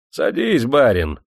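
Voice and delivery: a hint of an eager host. A narrator's reading. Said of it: "сказал цыган".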